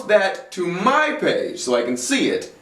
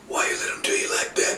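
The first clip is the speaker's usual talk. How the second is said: softer breathy repeat of the taunt; whispered taunt; 6 dB quieter, faster than usual. Acted. Taunting Big E a second time, whispered.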